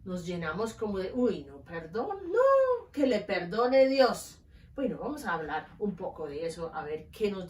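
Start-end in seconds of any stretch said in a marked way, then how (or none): 1.1-4.4 mock indignant refusal.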